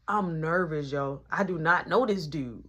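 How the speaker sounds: normal talking voice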